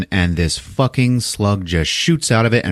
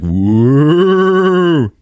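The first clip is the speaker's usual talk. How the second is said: long ghostly "wooo", rising high and loud; play-scare someone with a swelling ghost noise; mock spooky "whoa"; 8 semitones higher, 6 dB louder than usual. Boyfriend tries to scare her with flashlight, mock spooky. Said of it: "tries to scare her with it".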